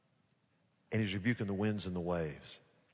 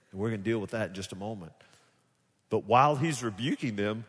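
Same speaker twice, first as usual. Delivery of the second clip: ordinary talk, nothing marked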